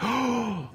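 high, drawn-in gasp; sharp gasp. Real, not acted. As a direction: suck in an audible breath. Own gasp, no words.

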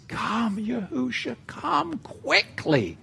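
hushed, slow, yearning prayer; quiet, slow heartfelt call; soft soul cry. Acted. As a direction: breathe it out as a longing plea. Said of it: "The soul cries".

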